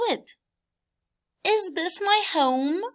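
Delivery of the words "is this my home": high, lifted bug voice with a rising question; light bug voice, curious; little bug asking. Acted. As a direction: ask it as the small hopeful bug. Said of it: "the bees say".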